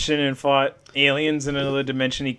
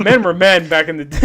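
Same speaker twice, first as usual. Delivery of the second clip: laughing; 2 semitones higher, 6 dB louder than usual. Real, not acted. Mock macho line while laughing.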